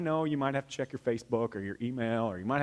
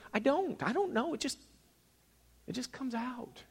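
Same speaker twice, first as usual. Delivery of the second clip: modest parents' reply; 8 semitones higher, 7 dB quieter than usual. Retold or imitated. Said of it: "their reply is always".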